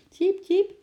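high, loud little peeps; very high-pitched animal chirp; squirrel chirp. A narrator's reading. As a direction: cheep like a chatting squirrel. Squirrels chirping to each other.

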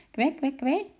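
high squeaky little penguin call; penguin quack. A narrator's reading. Penguin calling to his friends, high quack. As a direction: chirp it like a tiny penguin.

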